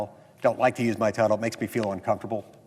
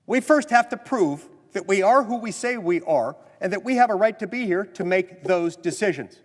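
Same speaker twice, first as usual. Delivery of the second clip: higher, louder floor-speech oratory; formal speech; 8 semitones higher, 4 dB louder than usual. Acted. Senator addressing the Senate, rising oratory. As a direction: drive the point home to the chamber.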